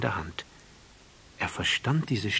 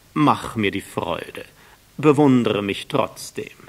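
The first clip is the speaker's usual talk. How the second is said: much louder, slow pleading; begging vain man; 3 semitones higher, 10 dB louder, slower than usual. A narrator's reading. The vain man begging the prince to admire him, loud and slow. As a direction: beg for admiration anyway.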